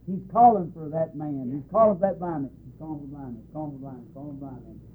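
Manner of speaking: very soft, falling away to a murmur; word passed along. Retold or imitated. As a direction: murmur it like news passed down a crowd.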